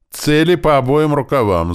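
loud, high lookout's call; shouted alarm. A narrator's reading. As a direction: cry the warning down from the tower. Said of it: "закричали со смотровой башни". (громкий тревожный крик дозорного)

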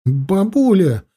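high, drawn-out glad call; happy, emotional exclamation; relieved cry. A narrator's reading. Boy relieved to see his grandmother.

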